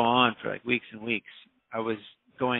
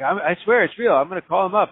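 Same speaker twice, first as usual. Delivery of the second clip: higher, louder pleading insistence; insistent protest; 8 semitones higher, 7 dB louder than usual. Retold or imitated. Errand kid begs the storyteller to believe him, higher and louder. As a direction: swear it's real, voice up and loud.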